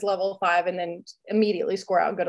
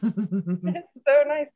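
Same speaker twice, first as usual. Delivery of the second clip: slow and drawn-out, savoring every word; lingering, dreamy relief; blissful sigh; 3 semitones higher, much slower than usual. Retold or imitated. Repeats savoring weekend away.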